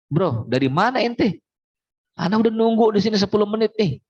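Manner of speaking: high, quick joking buddy voice; teasing friend. Acted. Friend greets husband, teasing.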